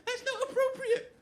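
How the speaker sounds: very high, mocking falsetto imitation; uppity Angela. Best man mimicking Angela's objection, uppity and squeaky. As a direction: mimic a prim, uppity scold. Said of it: "getting all uppity and saying".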